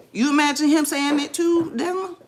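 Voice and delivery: high, loud delighted question; excitedly checking for agreement; excited surprise. Real, not acted. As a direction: ask it thrilled, pitch way up. Delighted someone imagines it too.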